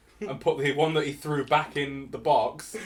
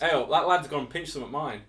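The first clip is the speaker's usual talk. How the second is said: Nottingham sentence; 3 semitones lower, 4 dB quieter than usual. Shown as a demonstration. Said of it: "Go in sections".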